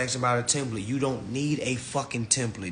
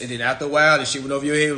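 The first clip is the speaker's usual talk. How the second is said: normal talking voice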